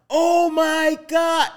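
high, long-drawn mock wail; acted-out "crushed" reaction, stretched and high; imitated parental dismay. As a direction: draw it out high, like a heartbroken parent. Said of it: "Like, my mom cried".

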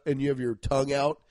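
ordinary talk

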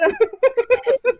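high quick laugh. Real, not acted. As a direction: break into a laugh. Laughs at Gabi's reaction. (risada aguda)

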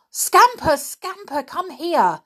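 high, loud call to the dog; shouting. A narrator's reading. Peter calling his dog away from danger, shouting. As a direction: yell for the dog. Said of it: "shouted Peter".

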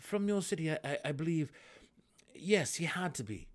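stammering, flustered rush; sputtering. A narrator's reading. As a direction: trip over the words. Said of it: "Drizzt sputtered".

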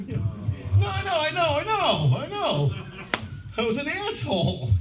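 very high, comic protest; high-pitched, self-mocking concession; squealed admission. Real, not acted. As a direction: own up in a squeaky wail. Protests to audience reaction, owning mistake.

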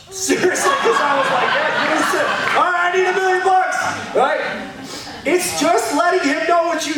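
laughing, high-pitched mock demand for a million bucks; a cheeky, giggling joke prayer. Real, not acted. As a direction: ham up a greedy prayer through laughter. Joking about praying for money.

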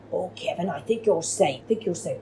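soft, secretive reassurance; conspiratorial hush. Retold or imitated. Memphis quietly reassures Kevin in the supply room, hushed.

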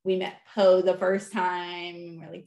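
ordinary talk

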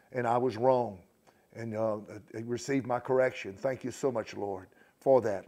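loud, earnest congregational prayer; spoken prayer. Real, not acted. Pastor asks the Lord to teach the congregation, praying aloud. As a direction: pray out firmly before the gathering.

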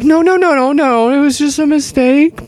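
high, overly nice no no no; high, sugary backpedal to the manager; mock sweet reassurance. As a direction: act falsely polite to the manager. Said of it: "And I'm like".

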